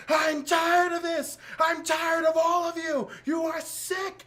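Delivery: high, loud and slow, fed-up ranting yell; furious villain shout. Retold or imitated. Zod screaming at the Revenge Squad, slow high angry shout. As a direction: spit every word like a tyrant losing patience. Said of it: "And he's like".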